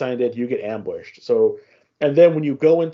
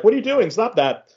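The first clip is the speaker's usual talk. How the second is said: high, mock-scolding partner voice; 8 semitones higher than usual. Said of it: "his partner's all like".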